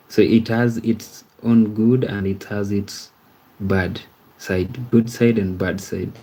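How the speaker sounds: normal talking voice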